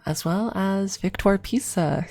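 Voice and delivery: usual speaking voice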